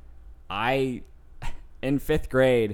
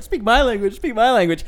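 higher, louder mocking parrot; mocking echo; 8 semitones higher, 8 dB louder, faster than usual. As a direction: throw his words back at him, teasing. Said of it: "And I was just like".

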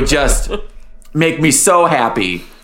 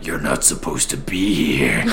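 low, quiet and menacing; deep ominous warning voice; 12 semitones lower, 7 dB quieter than usual. Retold or imitated. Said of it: "it's so great and it's so, like, ominous".

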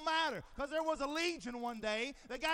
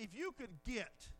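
normal talking voice

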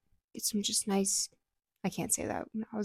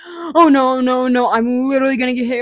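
panicked; 3 semitones higher, 4 dB louder than usual. Retold or imitated. Retelling panic before crash.

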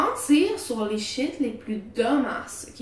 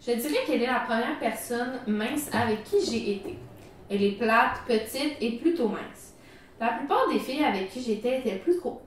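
story reading; 4 dB quieter than usual. Acted. Reads the Reddit story aloud.

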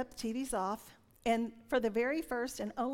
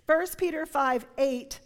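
ordinary talk, nothing marked

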